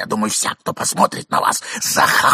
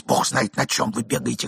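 usual speaking voice

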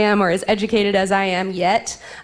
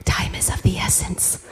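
unvoiced solemn whisper; 2 semitones lower, 7 dB quieter than usual. Mother retells son staring at the hourglass, whispered. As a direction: hush it like a grave secret. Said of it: "He whispered".

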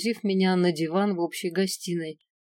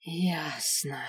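slow, softer resignation; subdued, doomed acceptance; resigned sigh; 2 semitones lower, 4 dB quieter than usual. A narrator's reading. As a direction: sigh the word out, defeated. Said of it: "обречённо вздохнул дракон".

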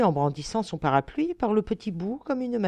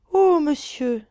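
high, raised plaintive exclamation; little girl's sighing protest; 9 semitones higher, 6 dB louder, slower than usual. Acted. Little Zoé jolted awake among the passengers, plaintive sigh. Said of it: "soupira la petite Zoé".

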